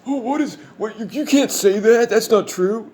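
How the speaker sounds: very high, flustered put-on voice; mock queasy objection. Upset viewer objecting to the videos, mock flustered squeal. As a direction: stammer in hammed-up outrage, way up high. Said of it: "have a stomach turning like".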